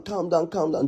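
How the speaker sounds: ordinary delivery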